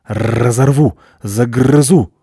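loud growled barks, rolled R; ferocious dog voice; threatening guard dog. Acted. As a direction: snarl out threats.

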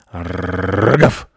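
rolled growl bursting into a bark; acted dog lunging at an intruder; guard dog bark. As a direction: snarl the R, then bark. Chained guard dog lunges barking.

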